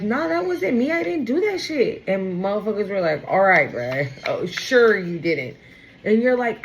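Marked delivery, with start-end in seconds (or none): 0.0-2.0 higher protesting voice; indignant denial. Retold or imitated. Acted denial of accusation. 3.3-5.6 lower, slow mocking doubt; sarcastic disbelief.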